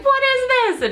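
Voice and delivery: high squealed mock disbelief; acted high-pitched amazement; freaking out. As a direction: squeal it, retelling your freak-out. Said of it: "I'm freaking out".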